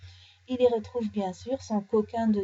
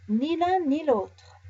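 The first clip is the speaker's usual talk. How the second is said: put-on teasing child; 5 semitones higher, 4 dB louder, slower than usual. Children tease the guessing bear.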